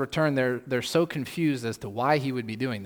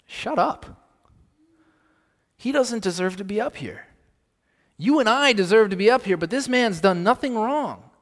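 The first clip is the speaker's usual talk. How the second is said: much higher, loud, indignant rebuke; other thief's rebuke; 8 semitones higher, 7 dB louder than usual. Retold or imitated. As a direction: snap at the mocking thief. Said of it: "The other thief looks at him and says".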